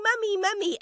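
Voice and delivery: put-on high, eager child's call; excited kids calling. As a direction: squeal for mummy like an excited kid. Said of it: "the kids were all jumping on me, going".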